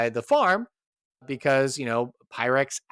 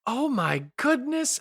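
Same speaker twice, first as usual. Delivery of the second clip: high, slow gushing wonder; delighted surprise; 11 semitones higher than usual. Acted. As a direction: coo over the little boy. Adventurer delighted by the child.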